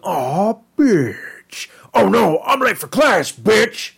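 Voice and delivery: high-pitched hammed-up monster voice; Scary Terry. Reviewer trying out the monster's sound, high put-on voice. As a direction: attempt the cartoon bogeyman, stretching the insult. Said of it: "trying to remember how Scary Terry sounded".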